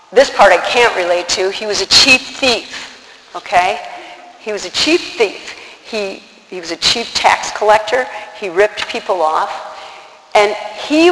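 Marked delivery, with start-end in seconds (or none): none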